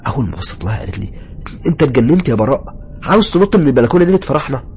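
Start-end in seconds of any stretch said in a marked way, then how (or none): 1.7-4.6 high, loud alarmed outburst; frantic, raised-voice scolding; screaming wife. Acted. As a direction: yell it in shocked disbelief, pitched right up. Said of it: "سمعت صوت مراتي وهي بتصوت".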